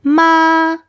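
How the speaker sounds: Ma held high and level; "Ma" high, long and even; first tone on Ma. Shown as a demonstration. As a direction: sustain "Ma" on one high pitch. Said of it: "if you say".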